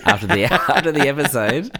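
talking through a laugh; giggling mid-sentence. Real, not acted. Joking about emailing topic.

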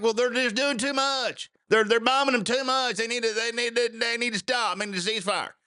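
high-pitched mock protester voice; put-on high voice for hand-wringing objectors; mocking complaint. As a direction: imitate the critics in a high, pleading whine. Mocking critics' ceasefire demands.

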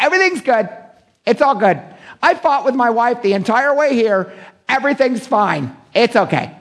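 high, sing-song pretend-everything's-fine voice; mock the forced smile; fake cheerful churchgoer. Churchgoer hiding a fight with his wife, high fake cheer.

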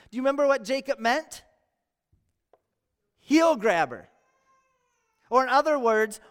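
normal talking voice

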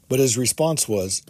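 plain reading voice

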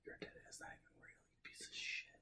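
whispered insults, breathy and close; playful ASMR whisper trading insults with a friend; mock ASMR whisper. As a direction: hiss it like a joke ASMR video. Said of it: "That's enough A S M R".

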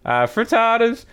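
a dotty lady puzzled by the garden; high fussy townswoman. Acted. Townswoman character voice.

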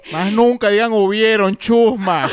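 loud mock-outraged reprimand; scolding character. Host copying the viral character's catchphrase, mock scolding. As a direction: scold like an indignant grammar policeman, for laughs.

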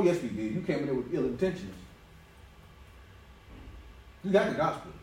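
ordinary talk, nothing marked

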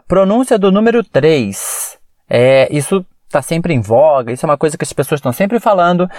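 ordinary talk, nothing marked

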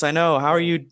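usual speaking voice

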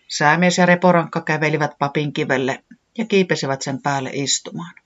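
ordinary delivery, nothing marked

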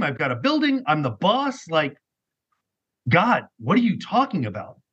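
high-pitched, disbelieving pushback; incredulous protest. Retold or imitated. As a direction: argue back in baffled disbelief. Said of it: "I about lost my shit".

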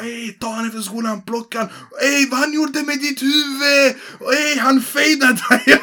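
high, slow mock jeering; rowdy kids. Kids in the corridor hyping a fight, high mock jeer. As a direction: egg him on like a pack of twelve-year-olds.

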